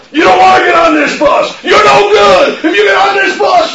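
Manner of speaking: high, loud drill-sergeant yell; screaming at stragglers. Retold or imitated. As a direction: bellow them away from the bus. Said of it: "I'd scream at people".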